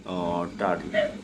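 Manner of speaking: normal talking voice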